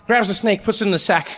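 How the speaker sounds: ordinary talk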